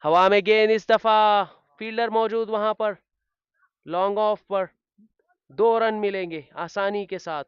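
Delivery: high, loud call of the ball in the air; excited cricket commentary. Acted. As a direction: ramp up as the ball flies.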